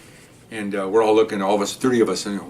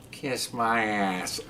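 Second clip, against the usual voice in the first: very slow, soft parrot voice; cussing parrot; 2 semitones lower, 11 dB quieter, much slower than usual. Retold or imitated. Parrot told to go to sleep, slow bird voice. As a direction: draw it out like a talking bird. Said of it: "the bird looks at him".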